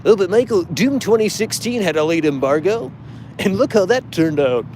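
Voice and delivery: hammed-up fanboy rebuttal; mocking fanboy. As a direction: parody a smug know-it-all fan. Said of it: "What does that have to do with anything?".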